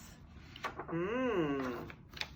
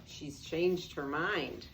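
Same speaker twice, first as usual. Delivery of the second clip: ordinary delivery, nothing marked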